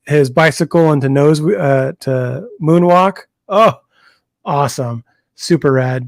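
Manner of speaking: ordinary talk, nothing marked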